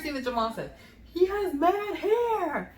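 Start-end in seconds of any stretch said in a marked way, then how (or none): 1.1-2.7 very high, slow marvel; excited imitation of a new dad; retold amazed husband. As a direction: draw each word out high in wonder. Husband marvels at baby's hair.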